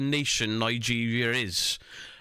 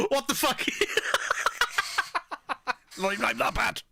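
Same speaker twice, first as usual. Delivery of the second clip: laughing; 10 semitones higher, faster than usual. Real, not acted. Laughing at bad play.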